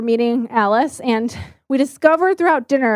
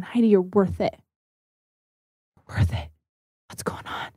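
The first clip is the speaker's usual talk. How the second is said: low, very soft, falling to a whisper; hushed reassurance; 5 semitones lower, 7 dB quieter than usual. Retold or imitated. Crying friends tell her she is worth it, hushed. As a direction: imitate a friend's gentle, near-whispered comfort.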